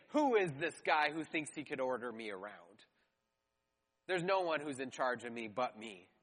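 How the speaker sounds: low, sneering dismissal; mocking tone. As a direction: scoff like a proud king. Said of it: "it is a mocking tone".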